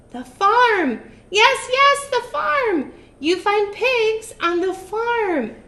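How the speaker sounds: high, loud, thrilled witch voice; excited witch. Acted. Witch thrilled at her circus idea. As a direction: squeal it with glee.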